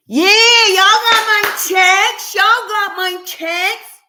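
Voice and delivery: high, loud and slow, drawn-out singsong; singsong excitement. Real, not acted. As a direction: sing it out to the chat with glee. Singsong delighted check-in.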